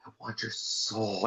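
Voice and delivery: a hint of The Rock, hushed and slow; lightly voiced creepy Rock, dropping to a hush; imitating The Rock. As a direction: lean in and murmur it slowly. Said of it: "he was like".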